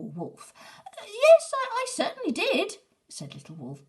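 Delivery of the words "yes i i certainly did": much higher and much louder, stammering defiance; puffed-up squeak. Acted. Little Wolf insisting to Big Wolf, high and loud. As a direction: puff up and insist like a small pup. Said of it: "puffing himself up".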